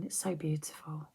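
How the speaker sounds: usual speaking voice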